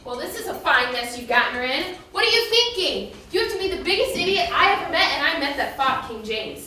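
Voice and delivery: loud angry scolding; yelling. Acted. Scolding him for endangering Jocelyn, yelling. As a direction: tear into him, loud and heated. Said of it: "You're yelling at me".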